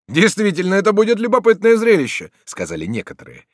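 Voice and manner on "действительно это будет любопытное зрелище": high, loud voice from the crowd; calling out. A narrator's reading. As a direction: call it over the others. Attackers call out in the crowd. (громкий возглас из толпы)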